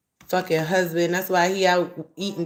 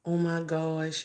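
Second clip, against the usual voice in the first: ordinary talk, nothing marked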